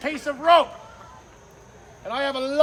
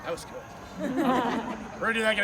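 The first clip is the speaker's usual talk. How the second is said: normal talking voice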